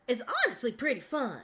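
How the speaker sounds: deep, easygoing country twang; country accent. Shown as a demonstration. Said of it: "Having a country accent like this".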